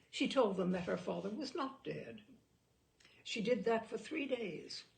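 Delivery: ordinary delivery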